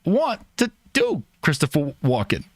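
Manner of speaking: halting celebrity impression with odd pauses and jumps in pitch; Christopher Walken. Streamer refusing the requested impression while doing it, halting Walken voice. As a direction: break the sentence up in strange places, as an impressionist would. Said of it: "Do Christopher Wa-, you can do Christopher Walken".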